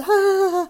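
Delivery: high, drawn-out "ah"; imitated strained high exhalation; acted wordless cry. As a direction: let out a long high-pitched vowel, acting out the strain. Acts out holding it together.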